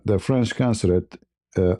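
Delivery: ordinary delivery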